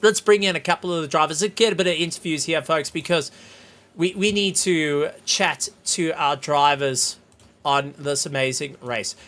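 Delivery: ordinary delivery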